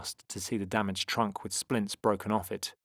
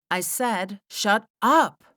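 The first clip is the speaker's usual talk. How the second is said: high snap building to loud UP; angry repeat; 12 semitones higher, 8 dB louder, much slower than usual. A narrator's reading. Mia repeats her order to Tom, loud and angry. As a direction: hit "up" hard and high.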